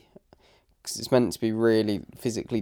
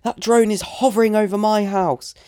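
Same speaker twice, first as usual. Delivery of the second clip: high-pitched mock indignant woman; complaining neighbour; 9 semitones higher than usual. Imitating the woman objecting to his drone, high complaining voice. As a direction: mimic an insistent neighbour, voice pushed right up. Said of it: "she says it again".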